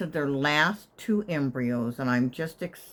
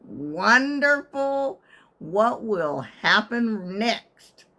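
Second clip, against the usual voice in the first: very slow, higher, drawn out; sarcastic; 4 semitones higher, 3 dB louder, much slower than usual. Retold or imitated. After the centipede scare, slow sarcasm. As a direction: drag each word with sarcasm. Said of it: "I was like".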